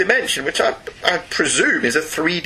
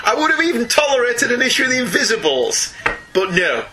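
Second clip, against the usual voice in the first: mock outrage; 7 semitones higher than usual. Mock outrage at non-superhero pick.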